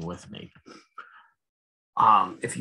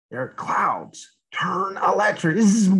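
put-on reading to children with rising excitement; dramatic storybook build; 7 semitones higher, 4 dB louder than usual. A narrator's reading. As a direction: make the storm sound big for kids. Reader dramatises lightning.

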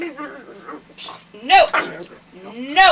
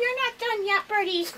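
ordinary talk, nothing marked

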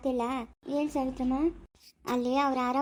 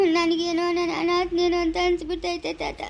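crying as he speaks; tearful; 3 semitones higher, 4 dB louder than usual. Acted. Grandson telling grandfather he feels like an orphan, tearful. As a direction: confess it through tears. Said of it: "ಯಾಕೆ ಅಳ್ತಾ ಇರೋದು?".

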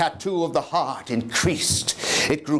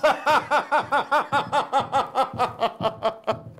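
long, high, breathy mad cackle; 8 semitones higher than usual. Acted. Madman cackling to his listener after the murder, long mad laugh.

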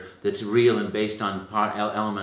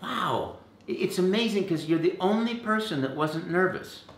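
amazed audience; 10 semitones higher than usual. Retold or imitated. Audience praising student after monologue, high awed voice. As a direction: gush in mock wonder. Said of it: "and people went".

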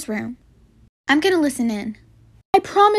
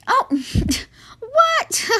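much higher feigned what; mock innocent surprise; 11 semitones higher than usual. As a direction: play dumb with a squeaky what. Counselor fakes innocence, laughing.

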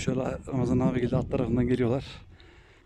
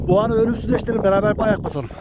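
higher, louder summit cheer; excited; 7 semitones higher, 4 dB louder than usual. Real, not acted. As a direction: call it out with triumph at the top. Reaching the summit together.

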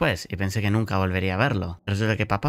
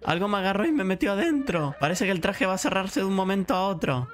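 put-on trapped child; 10 semitones higher than usual. Trapped child explains his plight.

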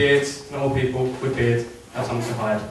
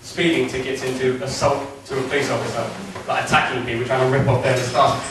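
normal talking voice

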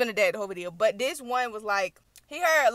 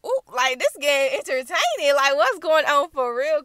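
excited; 3 semitones higher, 7 dB louder than usual. Retold or imitated. Her excited reaction to the drama.

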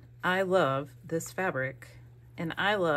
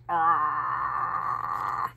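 long, low monster "ahh"; love monster; 3 semitones lower than usual. Pretend. Vlogger adores friend's handmade ephemera, playful love monster voice. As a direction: let out a playful monster noise. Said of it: "love monster voice".

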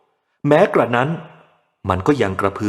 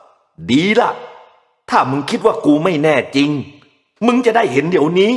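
enraged shout; 7 semitones higher than usual. A narrator's reading. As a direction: shout the threat in rage. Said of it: "มันตะโกนด้วยโทสะ".

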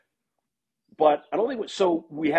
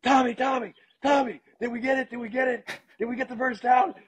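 high-pitched mock scream of an agitated coach; frantic retold screaming; 12 semitones higher than usual. Coach screaming at sideline reporter about a first down, imitated high yelling. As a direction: yell it like a coach desperate for the call. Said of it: "he's screaming at me".

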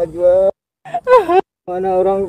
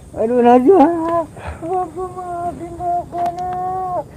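higher, slow, choked pleading; a crying father's plea; tearful apology; 12 semitones higher, 3 dB quieter, slower than usual. Acted. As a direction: beg your daughter's forgiveness through tears. Father weeps asking daughter's forgiveness.

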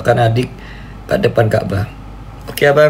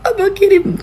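very high squeaked disbelief; shocked squeak. Real, not acted. Big gift sent again. (cicitan kaget sangat tinggi)

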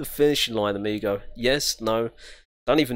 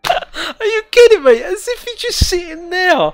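high, loud disbelieving cry through laughter; incredulous laughing shout; 13 semitones higher, 9 dB louder than usual. Real, not acted. Gamer yelling at a static policeman, laughing incredulously. Stuck behind a static cop.

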